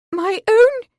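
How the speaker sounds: mother stunned by son's news; shocked. Acted. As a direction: gasp it out in disbelief.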